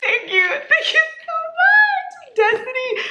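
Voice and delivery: overwhelmed squealed thanks; emotional squeal. Real, not acted. Thanking for the book.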